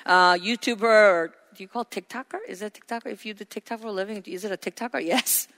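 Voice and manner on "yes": ordinary delivery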